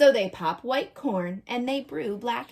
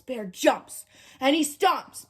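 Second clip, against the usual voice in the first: ordinary delivery, nothing marked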